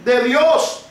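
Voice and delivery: rising, fervent preacher voice; building sermon cry; preaching. Acted. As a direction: preach it out, building to a slow shout. Preaching at full force.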